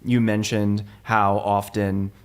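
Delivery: ordinary talk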